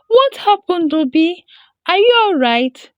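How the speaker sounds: high, loud and alarmed; frightened question. A narrator's reading. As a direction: rush to the brother in fear. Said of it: "she asked in fear".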